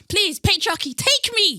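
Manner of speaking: high, loud, theatrical begging; comic cry of surrender; mock desperate plea. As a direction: plead at the top of your voice, hammed up. Acts out begging for a new surname.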